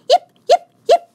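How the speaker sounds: very high sharp yips, then bouncing boings; tiny dog bouncing in; yippy tiny dog. A narrator's reading. Fifi yips and bounces toward Rosie, very high.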